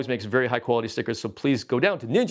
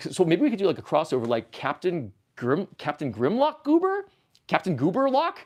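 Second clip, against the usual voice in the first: ordinary talk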